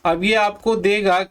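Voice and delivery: usual speaking voice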